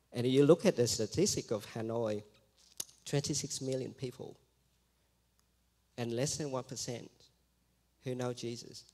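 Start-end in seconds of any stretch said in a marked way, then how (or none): none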